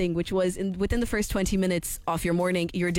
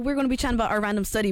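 usual speaking voice

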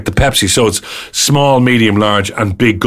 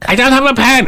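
mock diner girl; 13 semitones higher than usual. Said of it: "You know".

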